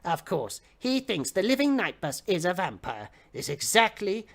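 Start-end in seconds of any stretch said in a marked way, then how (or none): none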